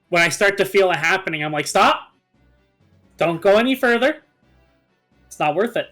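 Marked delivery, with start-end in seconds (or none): none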